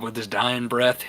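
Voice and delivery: ordinary talk, nothing marked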